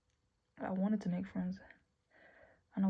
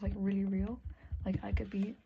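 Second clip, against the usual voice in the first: ordinary talk, nothing marked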